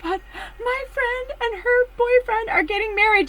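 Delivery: high giddy announcement; imitated giddy Charlotte; excited announcement. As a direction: announce the news bursting with joy. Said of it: "She's like".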